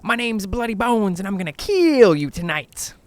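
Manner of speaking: loud, high taunting bogeyman voice; menacing bogeyman. Acted. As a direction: play the bogeyman for a campfire scare. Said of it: "Art leans into my face".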